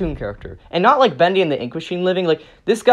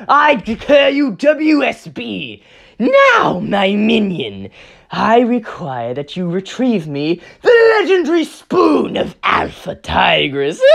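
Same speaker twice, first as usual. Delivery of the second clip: pompous villain decree; 3 semitones higher, slower than usual. Acted. Noir Rabbit commanding his minion, in a slow pompous villain voice. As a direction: savor each word like a dark lord.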